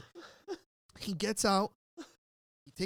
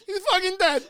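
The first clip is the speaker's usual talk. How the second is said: high, very loud screamed repeat; panicked yell; 13 semitones higher, 11 dB louder than usual. Retold or imitated. Screaming at the cops that the friend is dead. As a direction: shriek it like you can't stop. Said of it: "I'm like".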